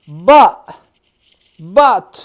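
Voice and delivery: a loud punched "But"; emphatic but. Shown as a demonstration. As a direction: hit "But" hard to set up the contrast.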